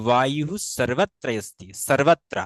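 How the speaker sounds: slow 'vayuh sarvatra asti' for বায়ু সর্বত্র অস্তি; Sanskrit pronunciation. Shown as a demonstration. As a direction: true 's' and 'v' in সর্বত্র. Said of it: "তখন আমরা কি বলি?". (সংস্কৃত উচ্চারণে বায়ু সর্বত্র অস্তি)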